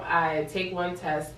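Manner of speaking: ordinary delivery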